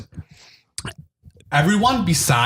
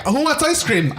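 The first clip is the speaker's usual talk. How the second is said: high-pitched retold offer; mock call-out; 10 semitones higher than usual. Repeats the excited offer to diners.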